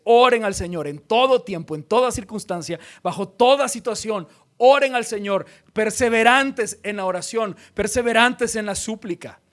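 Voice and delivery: más fuerte, exhortando; predicación ferviente. Acted. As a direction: clama a la congregación que ore. Predicación a toda fuerza. (el predicador exhorta a orar, en voz alta)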